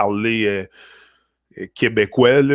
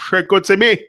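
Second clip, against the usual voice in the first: "Chicoutimi!" called out louder and higher, like a local from the Saguenay; Chicoutimi accent; 6 semitones higher, 5 dB louder than usual. Retold or imitated. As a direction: shout "Chicoutimi!" the way the locals say it, with a strong regional accent. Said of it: "ils ont un accent assez prononcé".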